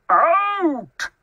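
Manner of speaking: loud, high-pitched order; commanding shout. A narrator's reading. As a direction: throw the intruder out with one loud word. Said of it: "commanded Bear".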